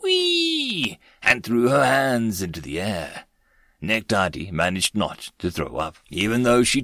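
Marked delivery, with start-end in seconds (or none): 0.0-1.0 very high, drawn-out gleeful squeal; child's whee. A narrator's reading. Small girl as the shuttle drops, high gleeful whee. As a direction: squeal like a little girl on a ride. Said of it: "The immature female human went".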